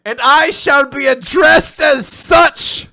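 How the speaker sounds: building to a slow, high, roaring shout; megalomaniac rant. Pretend. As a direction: rant like a mad self-crowned king. Escalating lordly rant, shouting.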